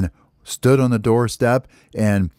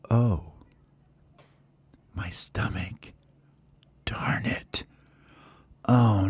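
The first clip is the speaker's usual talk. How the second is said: slow, soft bellyache moan, slipping into whispers; pained hushed groaning; 4 dB quieter, much slower than usual.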